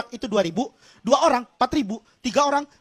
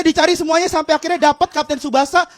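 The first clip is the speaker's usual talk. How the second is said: ordinary delivery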